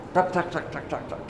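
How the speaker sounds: soft fading tik tik tik; tears falling. Retold or imitated. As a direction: tap out the dripping tears, dying to near silence.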